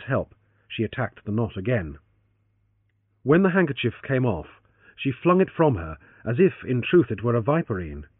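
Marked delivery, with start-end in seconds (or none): none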